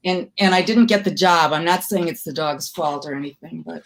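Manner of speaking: ordinary delivery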